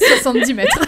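dit en éclatant de rire; réponse hilare, plus forte; en riant. Real, not acted. Joke about museum size. (en riant aux éclats)

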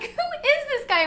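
very high, loud, drawn-out disbelief; incredulous. Retold or imitated. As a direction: squeal it in comic bafflement. Retelling disbelief at stranger.

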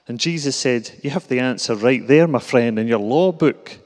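reading a comic Glasgow Bible in a thick Glaswegian accent; broad Glasgow accent. Shown as a demonstration. Preacher reading the Good Samaritan to the church in a broad Glasgow accent. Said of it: "put on my accent a bit and read a bit of comedy".

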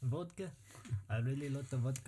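hammed-up Russian accent; mock Russian accent. Storyteller joins the joke about friend's dad, mock Russian accent. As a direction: play along as a joke Russian. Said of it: "I don't know how to do a Russian voice".

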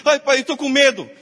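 lightly voiced frightened son; scared child. Son telling his father he fears the roach, a mild scared whine. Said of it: "Daí o Vitor".

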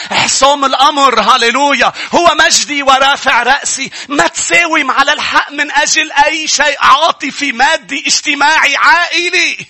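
high, loud rallying cry to the congregation; impassioned, high-pitched pulpit shout; full-force preaching. Real, not acted. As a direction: preach it at the top of your voice.

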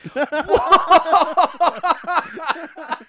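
long, high peals of laughter; big burst of laughter. Real, not acted. Co-host teasing about a breakup, cackling laughter. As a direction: laugh helplessly at a cruel jibe.

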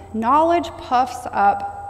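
drawn-out, deliberate words; preacher leading a call-and-repeat, slow; slow emphatic recitation. Shown as a demonstration. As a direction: spell it out slowly for the church to repeat. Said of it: "Say that with me".

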